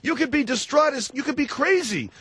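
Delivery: high, insistent, talking over the caller; worked-up, incredulous badgering; exasperated arguing. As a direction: hammer away, voice pitched right up. Host argues with evasive caller.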